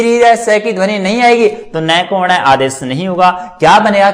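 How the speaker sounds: normal talking voice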